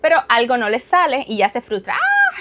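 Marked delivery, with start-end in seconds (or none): 1.9-2.4 sudden high mock shriek of a frustrated child; a toddler's frustrated scream, imitated; frustrated child's scream. Mother imitates daughter's tantrum: a sudden high shriek. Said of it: "Empieza a gritar".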